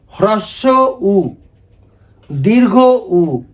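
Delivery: ह्रस्व उ and दीर्घ उ stretched very slow, each vowel held long; slowly. Shown as a demonstration. Teacher has the class repeat ह्रस्व उ, दीर्घ उ, very slow. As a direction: spell out ह्रस्व उ, दीर्घ उ slowly so students can follow. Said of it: "बोलिए धीरे धीरे".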